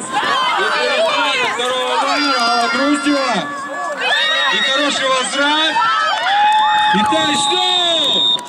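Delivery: excited rising play-by-play call; touchdown call. Real, not acted. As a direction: build up and shout the touchdown. Commentator calls interception and touchdown.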